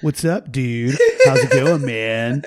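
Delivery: hammed-up California dude accent; faux California accent. Kid showing off a faux California surfer accent. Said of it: "you would adopt this faux California accent".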